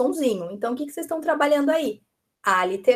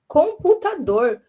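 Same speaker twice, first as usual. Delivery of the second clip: "Computador" loud, slow and clearly spaced; word given to pupil; 4 semitones higher, 5 dB louder, slower than usual. Shown as a demonstration. Teacher gives a child a word to segment, slow loud delivery. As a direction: present "Computador" deliberately, higher and louder. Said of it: "se eu falar pra ela".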